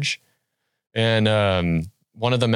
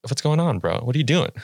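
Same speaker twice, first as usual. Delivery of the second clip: retold stunned, high-pitched aside; higher, lightly incredulous quote; mock disbelief; 6 semitones higher, faster than usual. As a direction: act out baffled disbelief to a friend, higher than usual.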